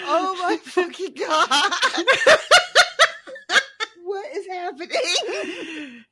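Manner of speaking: high, squealing through laughter; incredulous, cracking up loudly; helpless laughter. Real, not acted. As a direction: barely get the words out for laughing. Cracking up at the silly voices.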